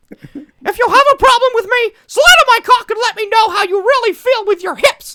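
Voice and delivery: high falsetto mockery of a tweet; squeaky cartoon voice. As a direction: read it like a squeaky Disney mouse. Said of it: "we should have done Mickey Mouse".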